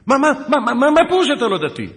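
very high, loud stammering yell; mock shouting, sputtering in disbelief; apoplectic. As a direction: imitate a sergeant about to burst. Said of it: "היה נראה שהוא הולך לקבל התקפת לב".

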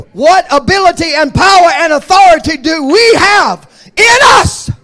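loud, ringing pulpit cadence, each phrase hammered home; full-force preaching. Acted. Preacher to his congregation, full-force shouted preaching. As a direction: preach it like a revival climax.

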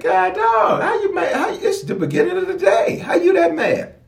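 high, laughing disbelief; laughing mock caller. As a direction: squeal how you that mad with laughter.

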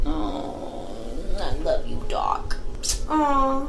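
a mock deep love confession to Doc; swooning fan. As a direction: sigh it like a lovestruck fan.

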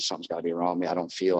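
ordinary delivery

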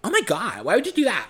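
high, shocked gossip voice; shocked friend. Retold or imitated. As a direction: gasp it out, scandalized and high. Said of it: "the dumb bitch, she goes".

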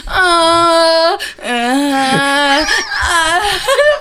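loud, high, drawn-out moans; mock moan. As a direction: imitate exaggerated stretching moans. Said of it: "you moan really loud".

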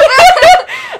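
very high loud shriek of laughter. Real, not acted. Her friend jokes welcome to the club, a loud shrieking laugh.

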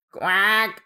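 normal talking voice

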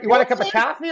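"coffee" as "cah-fee", Boston style, high-pitched; Boston accent. Shown as a demonstration. Joking about city accents with friends, Boston "coffee". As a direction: offer "coffee" with an open Boston ah. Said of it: "Boston and New York".

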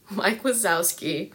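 ordinary talk

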